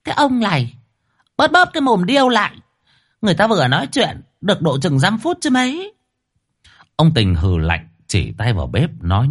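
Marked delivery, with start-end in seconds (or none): 0.0-5.9 high-pitched nagging wife voice; wife snapping back. A narrator's reading. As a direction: snap back at him in a high, fast voice. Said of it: "lườm lườm chồng rồi bảo".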